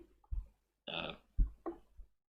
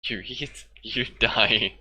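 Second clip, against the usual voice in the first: building to a loud threat; mock threat; 3 semitones higher, 9 dB louder, much slower than usual. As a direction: warn them off, menacing. Mock-threatening guard line.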